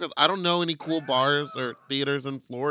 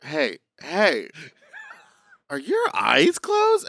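imitating Michael; 6 semitones higher, 3 dB louder, slower than usual. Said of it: "and was like".

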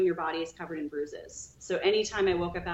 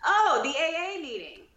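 higher and louder, calling out to the whole room; announcing to room; 9 semitones higher, 6 dB louder than usual. Retold or imitated. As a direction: broadcast it cheerfully to everyone. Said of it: "he says to this whole room".

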